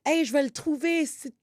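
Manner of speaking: high-pitched mock Québécois rant; Québécois French outburst. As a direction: imitate an irate Quebec woman. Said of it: "on the Quebec side".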